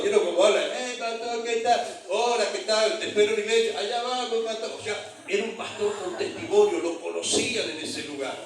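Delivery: usual speaking voice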